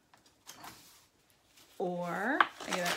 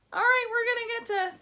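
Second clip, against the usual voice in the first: excited; 12 semitones higher, 5 dB louder, faster than usual. Acted. Excited number reveal.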